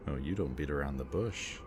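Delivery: ordinary delivery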